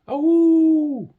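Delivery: very high, loud wolfish howl; strange howl. Acted. A creature howling in the forest at night, loud. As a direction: howl through the dark forest. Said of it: "He heard the noise again".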